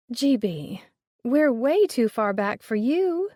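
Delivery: syrupy fake-sweet tone, drawn out; falsely sweet purr. A narrator's reading. Mother sweetly brushing off the collector, false-sweet purr. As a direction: purr it with sugary mock politeness. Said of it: "her mother purred with false sweetness".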